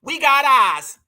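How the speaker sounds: imitating her Massachusetts accent on "We got eyes"; quick mock of a Massachusetts accent. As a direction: lightly mimic her Massachusetts twang on "got". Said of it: "Listen to that Massachusetts accent".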